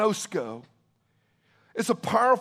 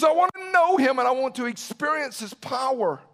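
high, insistent quote; fervent declaration; 6 semitones higher, 4 dB louder than usual. Retold or imitated. Preacher quoting Paul's desire, fervent declaration. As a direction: lift the voice high on the longing, then ease down.

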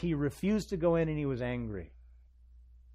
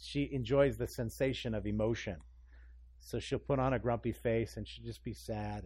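normal talking voice

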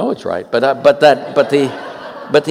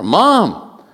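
put-on child calling out; 7 semitones higher than usual. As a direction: call mom again after the thunder. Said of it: "crack of thunder".

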